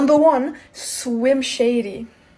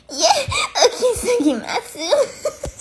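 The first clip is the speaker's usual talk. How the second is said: squealing laugh; giggling fit; high laugh burst; 11 semitones higher than usual. Real, not acted. Laughing mid challenge.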